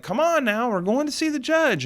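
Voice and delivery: high-pitched retold holler; mad calling out. Urging the attorney upstairs to the judge, retold mad holler. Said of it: "that made me mad".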